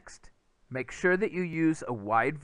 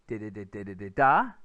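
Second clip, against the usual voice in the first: louder di beats rising onto a final stressed da; rhythm syllables; 5 semitones lower, 6 dB louder than usual. Shown as a demonstration. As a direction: chant the question's beat, lift at the end. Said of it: "it's gonna sound like".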